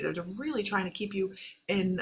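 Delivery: ordinary delivery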